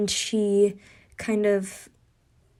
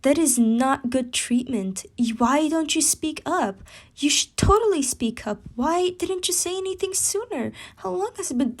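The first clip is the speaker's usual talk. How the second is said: higher and higher, indignant and urgent; indignant outburst; 5 semitones higher than usual. Acted. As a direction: build up a higher, faster indignant rant. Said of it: "Hinata would jump up, saying".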